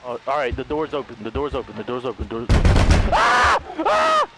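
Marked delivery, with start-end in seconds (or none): 3.1-4.3 sudden high loud shriek; jump-scare scream; terrified scream. Real, not acted.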